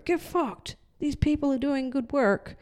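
high mock-defiant platform voice; defiant quote. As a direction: fling it back, lightly voiced defiance.

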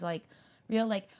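normal talking voice

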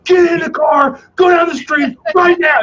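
high, commanding drill-sergeant bark; barking dad order. Retold or imitated. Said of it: "That's exactly how he said it to me".

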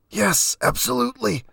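very high, choked agreement; squeezed, high, over-eager yes; strangled eagerness. A narrator's reading. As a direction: agree eagerly through a pressed throat. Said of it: "nodding as enthusiastically as he could with the tanjo pressed against".

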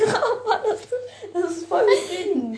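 high giggly relief while being scratched; laughing pleasure. Real, not acted. Friend scratches her back, she laughs with relief. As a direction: laugh through the words, it feels good.

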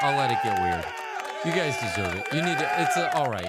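weird loud voice. Acted. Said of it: "This is for you all".

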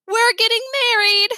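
put-on childish whisper with full mouth. A narrator's reading. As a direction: talk through a mouthful of taco.

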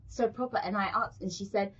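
ordinary talk, nothing marked

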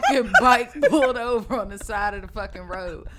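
loud, high, fast laughing rant; cracking up while ranting about the cop. Real, not acted.

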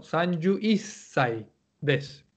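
niyu isai said slowly, syllable by syllable; slow demo niyu isai. Shown as a demonstration. Teacher modelling twenty-one for the class, slow. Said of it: "treinta y uno".

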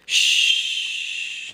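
long, forceful hush; drawn-out warning "shh"; cautioning shush. A narrator's reading. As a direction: shush them to keep a secret. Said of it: "he cautioned".

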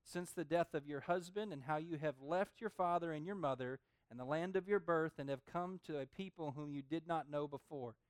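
soft, measured Bible reading; scripture reading. Acted. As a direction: read the verses gently, like holy text.

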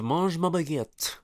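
hammed-up French, swooping high; mock the over-careful French pronunciation; pretentious fake French flourish; exaggerated French accent. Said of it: "put that much emphasis".

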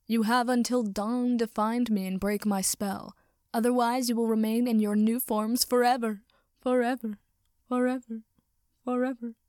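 loud booming echo, trailing off; echoing villain voice. Acted. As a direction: announce it like an echoing wizard. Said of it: "His voice echoed around the room".

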